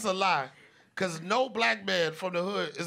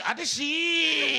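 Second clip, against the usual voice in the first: bowing younger man's greeting; respectful greeting; 9 semitones higher, 6 dB louder than usual. Pretend. Younger comedian greeting his elder, respectful Korean address. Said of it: "You better be like".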